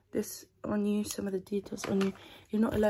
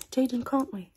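suddenly voiced and louder; 3 semitones higher, 7 dB louder than usual. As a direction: pop out of the whisper loudly. Same words repeated voiced and louder.

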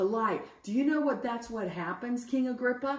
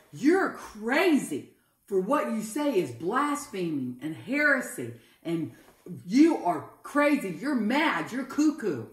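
loud accusation; 4 dB louder than usual. Acted. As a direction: accuse him loudly of madness. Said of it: "Festus with a loud voice said".

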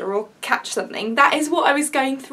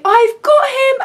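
high, loud call from afar; little voice; 12 semitones higher, 7 dB louder, slower than usual. Retold or imitated. Police officer calling to the searching mother, little high voice. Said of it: "heard a little voice say".

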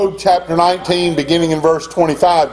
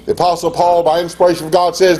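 ordinary delivery

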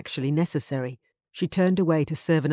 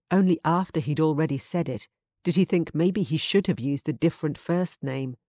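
ordinary delivery

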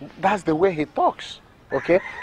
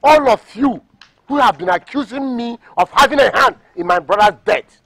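loud, raised-pitch outburst; angry defiance; 5 semitones higher, 9 dB louder than usual. Acted. Seller accused of his brother's death, angry defiance. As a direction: shout down the accusers.

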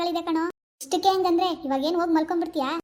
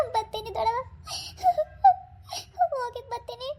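tearful goodbye; 6 semitones higher than usual. Acted. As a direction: say goodbye through tears, voice raised high and small. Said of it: "ಅಳ್ಬೇಡ ನೀನು". (ಅಳುತ್ತಾ ಮೆಲ್ಲನೆ)

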